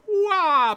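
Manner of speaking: high, loud martial-arts yell; Power Ranger cry. Retold or imitated. Game master voices the dodging ninja, Power Ranger yell. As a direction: yell like an action hero mid-cartwheel. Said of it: "Power Ranger style".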